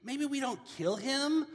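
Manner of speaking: high, hesitant brother voice. Retold or imitated. Judah talks his brothers out of killing, high and tentative. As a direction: pitch it up like a nervous brother proposing a way out. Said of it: "Judah, he's like".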